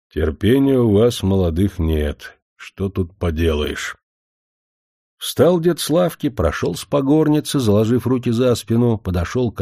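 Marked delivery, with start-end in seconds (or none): none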